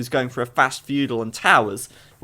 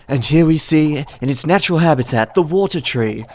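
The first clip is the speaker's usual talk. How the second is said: nature documentary; 6 dB louder than usual. Pretend. Mock nature show narration.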